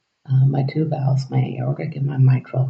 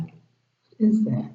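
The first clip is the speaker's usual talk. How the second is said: slow, higher, puzzled "what is that"; skeptical; 7 semitones higher, 4 dB quieter, much slower than usual. Retold or imitated. Patient doubting the doctor's promise, slow and puzzled. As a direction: drag it out like you don't buy it. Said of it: "And I'm like".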